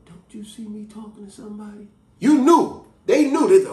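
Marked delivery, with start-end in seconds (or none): 0.1-1.9 hushed, pointed whisper. Retold or imitated. Child repeating grandma's whispered scolding to siblings, hushed. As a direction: imitate grandma's quiet scolding. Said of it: "whispered to you".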